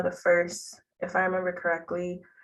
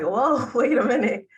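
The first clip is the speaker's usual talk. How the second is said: surprised, laughing, a little louder; amazed whoa; 6 semitones higher, 5 dB louder than usual. Retold or imitated. Student discovering public health, surprised laugh. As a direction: caught off guard by something great.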